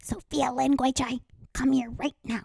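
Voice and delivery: a hint of a stern mom calling her daughter by full name, slow; mock mom summoning her child, slow and measured; imitating mom. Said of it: "My mom would say that".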